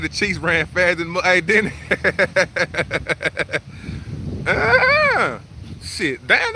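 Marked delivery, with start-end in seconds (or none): 4.5-5.4 high nasal bleat like a goat; goat bleat imitation. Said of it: "I knew how to speak goat".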